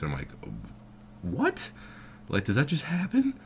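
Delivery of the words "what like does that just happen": very high, rising squeak of confusion; baffled disbelief. Retold or imitated. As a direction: act out a stunned, naive question. Retold bafflement at husband's casual remark.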